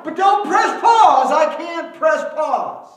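loud, high and slow pleading; high, slow, loud desperate protest; frantic cry. Retold or imitated. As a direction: shout it like you're desperate not to stop. Preacher mimics frantic refusal.

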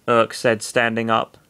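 usual speaking voice

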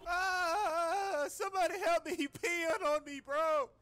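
very high, soft whimpering cry for help; high whining plea while being peed on; whiny wail. Acted. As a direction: squeal it out as he gets peed on.

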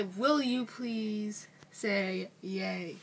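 very slow, drawn-out words; slow modelled request. Shown as a demonstration. Coaching the other on asking politely, very slow. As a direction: spell out the polite request word by word, slowly.

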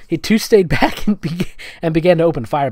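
normal talking voice

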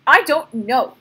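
choked voice. A narrator's reading. Girl starting to cry before the soldier, choked protest. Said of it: "she said, her voice choked".

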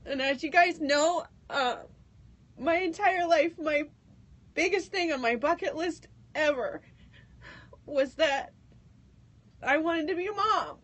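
high, tearful voice with long pauses; happy tears. Real, not acted. Host telling listeners she is pregnant, in happy tears. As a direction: fight through real happy tears to announce it. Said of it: "these are tears of excitement".

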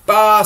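the whole flock bleating; sheep bleat. Acted. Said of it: "said all the sheep".

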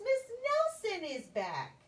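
squeaky put-on voice; high disguised voice. Disguised teacher voice.

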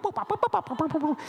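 very high, chirpy synth boops; rapid high synthesizer boops; electronic boops. Retold or imitated. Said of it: "it went".

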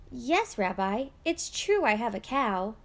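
ordinary delivery, nothing marked

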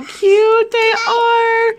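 cutesy gush. Real, not acted. Gushing over cute erasers.

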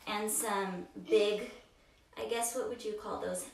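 ordinary talk

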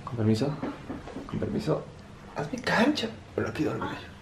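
soft, drowsy whispering off; sleepy murmur. Acted. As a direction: murmur it like dozing off. Sneaking off for a nap.

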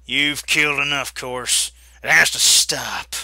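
loud, firm warning. Acted. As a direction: stand your ground and mean it. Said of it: "Josiah said firmly".

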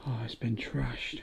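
hushed whisper in an empty ruin. Real, not acted. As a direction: breathe the words out, no voice. Whispered while exploring.

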